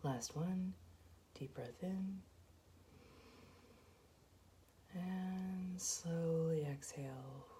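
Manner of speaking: soft, even meditation voice with long pauses; guided meditation. Acted. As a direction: guide them gently through each breath, hushed and unhurried. Leads a guided breathing meditation.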